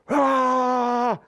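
sudden high, loud scream into a pillow. Retold or imitated. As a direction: let out a full-throated yell. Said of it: "I scream into the pillow as loud as I can".

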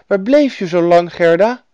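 put-on high, loud boy's voice; little boy. A narrator's reading. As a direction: ask suddenly as a child. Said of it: "zei hij opeens".

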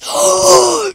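sharp high surprised gasp; sudden startled high intake of breath. Acted. Character gasps at recognition.

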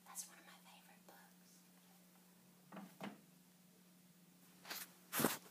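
barely audible, voiceless whisper. Real, not acted. Reader confiding to children about a favorite book, whispering. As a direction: whisper it like a small secret.

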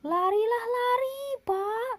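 high, pressing cry to run; urgent shout. A narrator's reading. Pak Badru yells at a stranger to run. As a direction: urge the man to flee.

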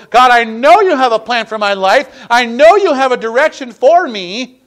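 building to a loud, high plea; fervent preaching. Acted. Preacher voicing the believer's prayer to God, fervent preaching. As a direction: cry out a model prayer with mounting fervor.

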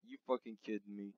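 ordinary delivery

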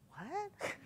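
high, soft, rising "What?"; baffled reaction. Retold or imitated. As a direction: squeak it quietly in mock disbelief. Doctor's baffled reaction, acted.

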